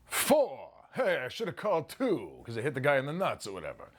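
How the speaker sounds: lightly voiced comic one-liner; a hint of Rodney Dangerfield's patter; Dangerfield joke. Rodney Dangerfield telling a joke on set, lightly voiced. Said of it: "I was like".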